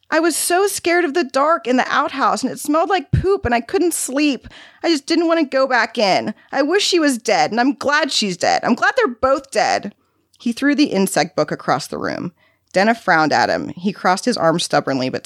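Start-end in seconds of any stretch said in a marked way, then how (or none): 0.1-9.9 put-on high-pitched, distressed child's voice; upset little boy. A narrator's reading. Boy confessing outhouse fears and hatred, upset. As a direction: pour it out like a hurt, defiant kid. Child pours out fear and anger.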